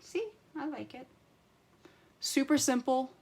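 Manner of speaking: chirpy satisfied sign-off; delighted. Real, not acted. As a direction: show off happily. Pleased with the result.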